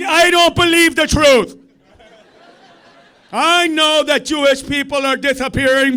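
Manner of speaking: mocking. Mocking the heckler's view.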